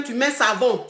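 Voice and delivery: ordinary talk, nothing marked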